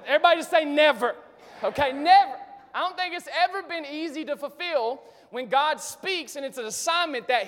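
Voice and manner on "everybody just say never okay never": loud call to the congregation; rally the room to answer. Real, not acted. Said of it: "calls congregation to respond".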